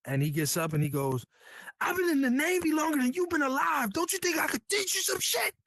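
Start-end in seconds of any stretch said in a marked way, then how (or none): 1.8-5.5 senior chief yelling. Retold or imitated. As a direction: yell it down at the young sailor like a furious chief. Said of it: "this dude's yelling in my face".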